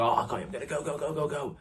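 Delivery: rapid-fire excited "go" chant, rising; mock over-eager. Pundit imitating a fighter who smells a knockout, mock excited. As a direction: play a fighter rushing in for the finish.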